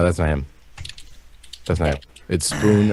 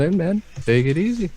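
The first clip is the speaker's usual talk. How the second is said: higher sing-off rising at the end; silly high voice; 10 semitones higher than usual. Pretend. Host bidding the caller farewell, silly high voice. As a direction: sign off in a put-on high voice. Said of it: "talking like this".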